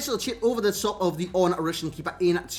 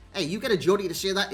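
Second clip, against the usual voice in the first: usual speaking voice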